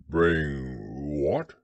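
low, slow-witted bear voice; puzzled low bear with a rise at the end; puzzled Pooh. A narrator's reading. Bear questions an unfamiliar word, puzzled low voice.

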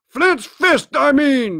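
high, loud, impatient outburst; angry cry. A narrator's reading. Blind man curses the money, crying out impatiently. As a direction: snap it back in exasperation. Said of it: "he cried".

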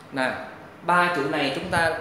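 normal talking voice

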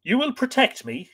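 high, pleading question; pleading woman's appeal; imploring. A narrator's reading. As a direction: implore a protector, voice lifted high. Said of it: "she implored".

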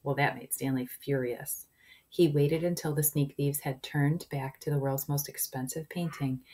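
plain reading voice

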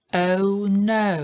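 "Oh no" with a silent h and a gliding diphthong; correct "Oh no". Shown as a demonstration. Teacher modelling the correct sound, clear diphthong. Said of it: "to jest nieme ch".

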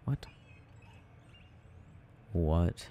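ordinary talk

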